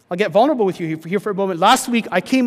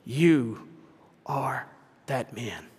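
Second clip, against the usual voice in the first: low, slow and much softer, each word weighed; grave accusation; 7 semitones lower, 6 dB quieter, much slower than usual. Retold or imitated. Nathan confronts King David with a low, solemn verdict. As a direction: look him in the eye and lay it bare.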